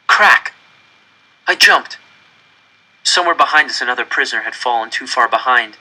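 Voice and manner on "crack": loud, high gunshot crack; sharp crack. A narrator's reading. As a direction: snap it out like a gunshot. Gunshot sound in narration.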